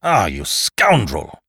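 loud, drawn-out curse; angry oath. A narrator's reading. Lupin ducking Holmes's attack, cursing angrily. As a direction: curse him slowly and forcefully. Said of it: "He ducked with an oath".

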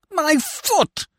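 high yelp of pain; pained cry. A narrator's reading. Scaramouche cries out in pain at his foot. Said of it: "he complained".

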